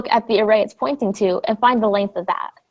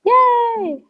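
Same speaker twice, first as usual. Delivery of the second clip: excited cheer; 13 semitones higher than usual. Real, not acted. Tutor cheers student's understanding.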